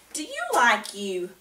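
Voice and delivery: ordinary talk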